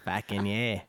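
low, laid-back Aussie mate; Australian accent. Shown as a demonstration. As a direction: drop low into a put-on Australian voice. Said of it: "talking in my Australian voice".

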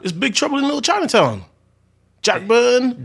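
incredulous. Real, not acted. Incredulous nobody knows movie.

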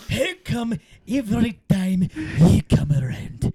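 lightly voiced Scottish lilt, rolling the words; sing-song Scottish go at the lyric; Scottish accent. Friend dares him to sing it Scottish, mock Scottish accent. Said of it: "All right, Scottish".

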